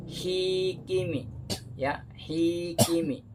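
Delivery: slow "hikimi" with the first "hi" held long and "ki", "mi" kept short; correct reading. Shown as a demonstration. Teacher to students: the right, slow "hikimi". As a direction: stretch only the first "hi" in "hikimi". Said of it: "yang benar ini".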